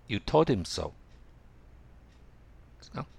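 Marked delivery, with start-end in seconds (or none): 0.1-0.9 "him" said as "im" with the h dropped, linked to "told"; "You told him so" run together as "tol-dim so"; reduced "him". Shown as a demonstration. Teacher modelling natural English, "him" weakened to "im". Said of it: "him、himですね".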